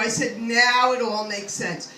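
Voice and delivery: ordinary delivery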